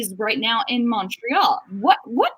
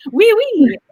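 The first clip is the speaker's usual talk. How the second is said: laughing mock French; 7 semitones higher, 6 dB louder than usual. Playful French reply, laughing.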